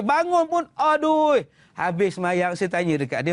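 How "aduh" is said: high, drawn-out "aduh"; mock a worshipper groaning in pain; imitated pain groan. Old man aching as he prostrates, high exaggerated groan. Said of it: "sakit gamaknya".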